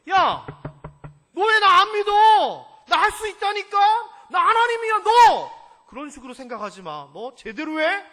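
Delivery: God scolding Abraham for doubting. Acted. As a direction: tell him off hard. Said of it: "야단치시는 거예요".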